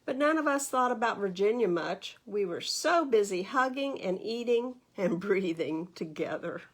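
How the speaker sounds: put-on expressive read-aloud to children; lively storybook narration for little listeners; story reading. A narrator's reading. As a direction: read it like a bedtime picture book. Reads picture book aloud.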